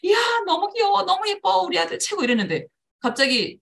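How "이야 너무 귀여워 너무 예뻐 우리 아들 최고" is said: high, gushing praise; doting praise. Shown as a demonstration. Mother gushing over her son's cuteness, high voice. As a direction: fuss over the child. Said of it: "막 귀엽게 귀엽게 재롱을 떨었더니".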